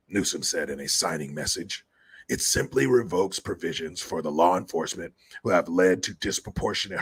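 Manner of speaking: low raspy mock reading of his statement; Newsom impression. Mocking Newsom's signing message, low rasp. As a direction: read the quote in a low sleazy rasp. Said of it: "this is Gavin".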